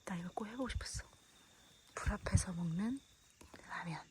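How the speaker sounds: soft unvoiced whisper. Real, not acted. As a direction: hush it so nobody wakes. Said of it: "목소리 크게 안 내서 돼서 좋다".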